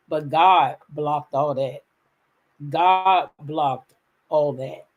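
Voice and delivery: drawn-out, deliberate, word by word; slow, testifying emphasis; slow emphatic declaration. Real, not acted. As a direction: savour each word slowly.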